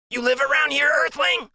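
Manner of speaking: put-on high, bright kid question, last word almost breathed out; high, eager kid voice; high curious Freak. A narrator's reading. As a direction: ask like a little alien greeting a visitor.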